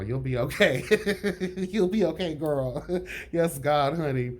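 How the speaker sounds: laughing as you tease, pitch climbing; amused teasing. Real, not acted. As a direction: tease them and break into laughter. Cracks up while teasing Rock.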